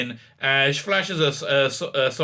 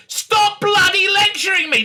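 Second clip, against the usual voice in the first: loud, high outburst at being lectured; mock-furious yell, full voice; exasperated shout; 6 dB louder than usual. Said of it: "he actually says, turns around and says".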